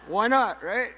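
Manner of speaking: high, loud and laughing; carefree why not. Retold or imitated. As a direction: toss it off with a big laugh, unbothered. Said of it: "I'm like".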